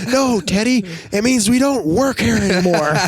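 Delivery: put-on high child voice, earnest and sure; a small boy setting his brother straight; little kid explaining. Dad imitating his five-year-old explaining the firing.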